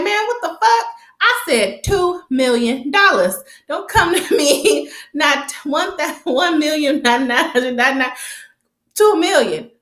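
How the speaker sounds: high, hammed-up outraged boss; mock fury over the missing millions; angry Vincent imitation. Vincent scolding the crew over money in a high mock rage.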